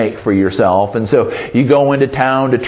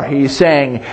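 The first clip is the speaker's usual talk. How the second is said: usual speaking voice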